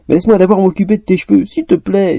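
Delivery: high, begging plea; pleading. A narrator's reading. Elf begging to fix the girl's hair, pleading. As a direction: coax her into it. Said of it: "supplia-t-elle".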